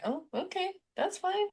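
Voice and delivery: high, soft mock-polite reply; awkward acceptance. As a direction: imitate someone taken aback, voice lifted and hushed. Mimics friends' awkward reply to coldness.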